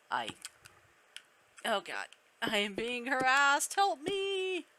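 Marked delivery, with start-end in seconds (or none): 2.4-4.7 rising mock cry for help; mock distress. Player jokingly calls for rescue from harassment, mock distress. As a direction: plead for help, pitch climbing high.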